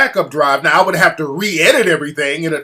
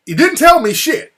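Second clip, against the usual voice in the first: much higher and louder, venting real anger; angry outburst; 11 semitones higher, 6 dB louder than usual. Real, not acted. Angry retelling of editor's betrayal.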